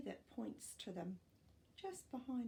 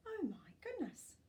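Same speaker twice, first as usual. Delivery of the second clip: surprised exclaim; 11 semitones higher than usual. Real, not acted. Reacting to seventeen pups.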